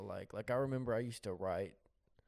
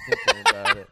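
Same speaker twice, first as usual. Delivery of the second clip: very high, loud, breathless climax; out of breath; 18 dB louder than usual. Pretend. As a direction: gasp out the end of a wild story. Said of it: "you got out of breath telling that story".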